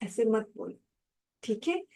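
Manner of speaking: a calm elder explaining kindly to a student; gentle advice. Retold or imitated. Authority gently counseling a student, softly. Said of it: "authority ने ना उसको समझाया".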